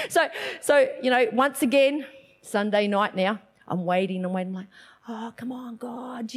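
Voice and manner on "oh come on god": very soft, under-the-breath plea; hushed pleading. Retold or imitated. Woman retells waiting on God for her mother, hushed pleading. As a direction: beg quietly, as in private prayer.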